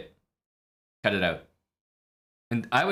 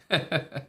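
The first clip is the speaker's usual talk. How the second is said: short laugh; 5 semitones higher than usual. Real, not acted. Laughing at bishop a six.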